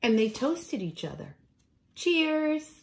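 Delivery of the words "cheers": ordinary delivery, nothing marked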